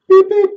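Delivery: two high squeaky beeps; quick high mouth beeps; imitated beeps. Shown as a demonstration. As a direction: mimic the pulse beeps. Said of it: "I can't even do it with my mouth".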